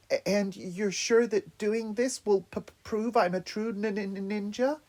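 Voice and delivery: high, nervous stammering; scared stutter. Acted. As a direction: stammer with fear. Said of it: "continuous vibrating with fear".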